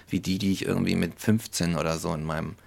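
normal talking voice

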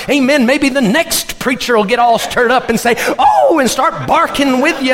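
rally the preachers, all stirred up; stirred-up preaching. Retold or imitated. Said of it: "Brother Farley gets stirred up and he says".

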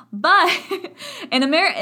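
bursting into laughter; laughing. Real, not acted. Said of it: "laughing after the demo".

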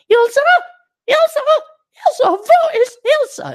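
very high squeal calling out for someone; shrill, loud mock panic in a woman's voice; frantic gal. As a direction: imitate a game heroine in a piercing falsetto. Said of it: "there's a gal. She's like".

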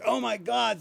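high, excited mock squeal; hammed-up delighted recognition; surprised gasp. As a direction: imitate a starstruck woman at a bar. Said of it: "they would say".

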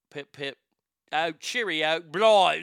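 mock British catchphrases; British accent. Shown as a demonstration. Host showing how they start, mock British accent. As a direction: warm up into a hammed-up British accent. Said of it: "That's how I start my British accent".

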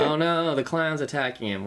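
high mock alarm; sarcastic fake fear. Mocking her reaction to movie.

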